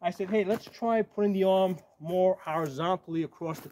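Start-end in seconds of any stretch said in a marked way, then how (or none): none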